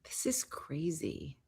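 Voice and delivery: soft whispered aside; whispering. Real, not acted. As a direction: breathe it out in a whisper.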